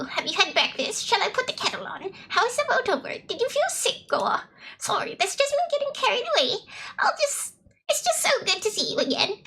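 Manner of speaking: high, swooping British granny voice; British grandma. Acted. Grandma fussing over her returning grandchild, high British granny voice. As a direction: play a fussing British grandmother, pitched well up. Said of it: "why the grandma is British".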